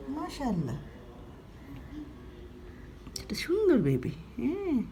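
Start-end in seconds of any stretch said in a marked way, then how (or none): 3.2-4.8 soft whispered praise. Real, not acted. Whispering to her pet that it is pretty.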